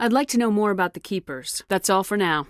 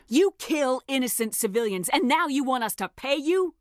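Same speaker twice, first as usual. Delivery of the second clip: higher, biting outrage at the demand; raised, indignant accusation; furious disbelief; 7 semitones higher than usual. Acted. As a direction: lash out at his nerve. Shepard berates warlord Darius.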